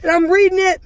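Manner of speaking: ordinary talk, nothing marked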